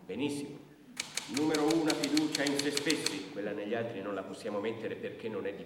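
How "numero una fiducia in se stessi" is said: much louder itemizing; official dictation. Acted. Brigadier dictating item one, loud and formal. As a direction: call it out for the record.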